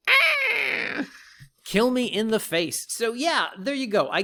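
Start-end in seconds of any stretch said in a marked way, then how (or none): 0.1-1.0 high strangled yelp of despair; anguished cry. Real, not acted. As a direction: let out a sharp pained shriek. Frustrated outburst at search term.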